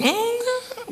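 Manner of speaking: very high, drawn-out squeaky no; mock denial. Preacher answering those who say he's scared, mock denial. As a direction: deny it in a comic high voice.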